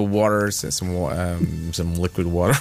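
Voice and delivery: put-on halting American accent on "water"; attempted American accent. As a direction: grope for an American way to order water. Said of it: "in an American accent".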